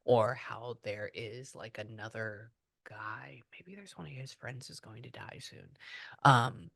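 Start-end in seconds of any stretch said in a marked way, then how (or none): 0.3-5.7 very soft, low musing; almost whisper it to yourself; hushed. Real, not acted. Medium wondering about the spirit's friends, hushed.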